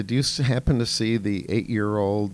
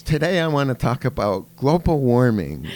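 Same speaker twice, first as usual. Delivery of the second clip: higher, louder mock child voice; a hammed-up schoolgirl presenter, pitched up; imitating a little girl; 5 semitones higher, 7 dB louder than usual. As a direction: play the kid doing a grown-up speech, higher and louder. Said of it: "And she goes".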